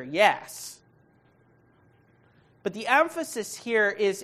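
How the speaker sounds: ordinary delivery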